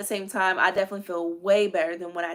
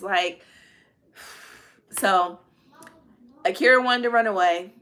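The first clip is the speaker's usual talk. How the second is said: ordinary delivery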